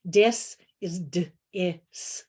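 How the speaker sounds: dis said slowly, then split d-is; slow teacher sounding out of dis. Shown as a demonstration. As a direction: pull dis apart sound by sound for kids. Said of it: "So quick example".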